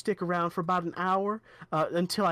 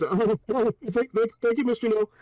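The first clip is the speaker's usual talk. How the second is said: louder, higher stammering thanks; overjoyed guard; 6 semitones higher, 5 dB louder than usual. Acted. Clint guard thanking Miss Janelle, loud and giddy. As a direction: gush out your thanks.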